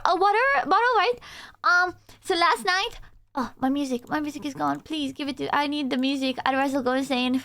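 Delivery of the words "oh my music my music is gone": ordinary delivery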